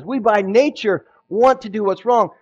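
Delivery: loud, high, impassioned sermon; fervent preaching. Acted. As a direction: preach it with fire. Preaching at full force.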